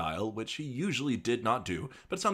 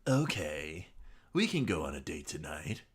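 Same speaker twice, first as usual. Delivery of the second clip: sexy lady voice; 4 semitones lower, 3 dB quieter than usual. Acted. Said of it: "best sexy lady voice".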